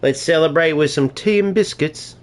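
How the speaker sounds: put-on a playful British cowboy accent; English-Texan mix accent. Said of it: "this is my English slash British mix accent".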